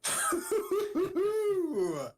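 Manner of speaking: cracking up, falling away at the end; real burst of laughter. Real, not acted. Player laughs at bad roll.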